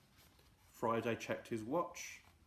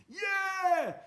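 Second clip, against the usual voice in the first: high shrill goblin shriek; goblin scream; 6 dB louder than usual. Acted. Goblins cheering Gum's plan, screaming high. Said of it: "screamed the goblins".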